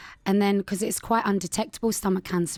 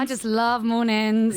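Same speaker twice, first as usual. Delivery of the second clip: sarcastic; 3 semitones higher than usual. Real, not acted. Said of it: "is that sarcasm?".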